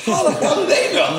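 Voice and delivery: high squeal of laughing disbelief. Retold or imitated. Host laughing at UK commenters, high incredulous squeal. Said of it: "I'm like".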